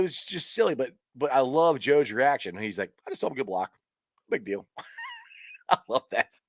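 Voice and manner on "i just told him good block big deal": nonchalant. Retold or imitated. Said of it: "Joe is so nonchalant and cool".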